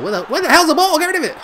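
high, loud frantic outburst; panicked yell. Real, not acted. Gamer hunting for a loose ball, panicked yell. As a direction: yell it in rising panic at your defence.

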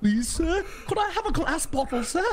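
high-pitched mock beggar's plea; Oliver-style orphan begging; pleading beggar. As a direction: beg politely in a high, put-on voice. Begging bit after charity joke.

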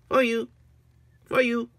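high, short gift-giving phrase; minion voice. Acted. Minion giving Agnes the unicorn, high-pitched. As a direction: chirp it like a small minion. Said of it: "he just said".

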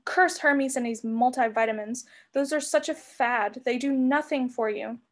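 ordinary delivery, nothing marked

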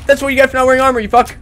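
loud high gloating; triumphant yell. Real, not acted. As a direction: yell it in victory. Killing enemy triumphantly.

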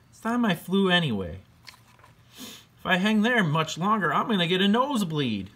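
chirpy bat Charlie. Acted. Bat agrees to help.

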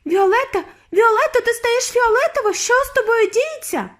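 very high, loud hysterical mother; panicked screaming. A narrator's reading. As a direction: shriek it all in mounting panic. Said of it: "кричала пані Борогар".

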